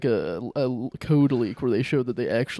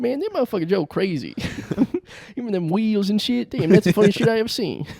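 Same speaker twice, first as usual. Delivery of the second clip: high-pitched, animated Crenshaw guy; Crenshaw guy impression; 7 semitones higher than usual. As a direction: act an amazed local fan, pitched up.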